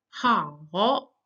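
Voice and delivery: "ha, ho" dipping low then rising; third tone. Shown as a demonstration. Teacher shows the word for fine, "ha, ho" dipping and rising. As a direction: fall on "ha", climb up on "ho". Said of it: "it's pronounced like this".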